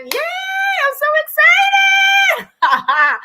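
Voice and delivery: very high squealed celebration; drawn-out high-pitched whoop of joy; excited cheer. Real, not acted. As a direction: stretch it out in a high, gleeful squeal. Cheering Don's good news.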